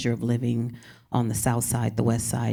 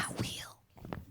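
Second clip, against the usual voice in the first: real whisper, no voice; whispered aside; 10 dB quieter than usual. Real, not acted. Answering the host's request to read, in a whisper. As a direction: breathe it to the host off-mic. Quiet aside during the reading intro.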